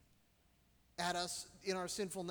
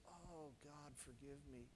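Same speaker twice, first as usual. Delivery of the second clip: hushed, broken plea to God, much softer; a quiet, tearful cry for mercy; tearful prayer; 5 semitones lower, 16 dB quieter than usual. Retold or imitated. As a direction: murmur the prayer as if through tears. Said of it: "I was in tears".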